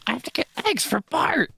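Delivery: bad Marge Simpson. Brother's meme, a bad Marge Simpson impression. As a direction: do a deliberately terrible Marge. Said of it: "really badly impersonated Marge Simpson".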